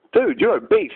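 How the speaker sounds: a chicken mocking a fat man, high and cheeky. Chicken voiced in absurd story.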